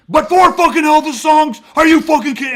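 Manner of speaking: loud, high outburst of outrage; freaking out. Retold or imitated. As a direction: suddenly blow up, yelling in disbelief. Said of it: "the guy fucking freaks out again".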